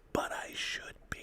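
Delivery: breathy throwaway whisper; whispered aside. Real, not acted. Host whispering a joke to his co-host, a sly whisper. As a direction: whisper it as a cheeky confession. Joking aside after denial.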